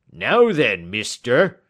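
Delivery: loud, indignant challenge from a stallholder; burst of anger. A narrator's reading. Salesman confronting a questioning customer, angry. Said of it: "a burst of anger from the salesman".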